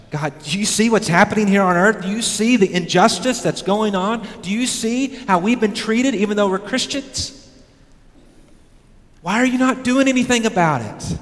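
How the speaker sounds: high, urgent pleading voice of the martyrs. Acted. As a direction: press God for an answer, rising in pitch. Martyrs' plea voiced to God.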